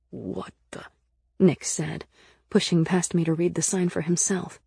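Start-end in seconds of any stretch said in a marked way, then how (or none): 0.1-0.8 low, hushed character voice; soft, deep, puzzled murmur; baffled mutter. A narrator's reading. Nick baffled reading the sign.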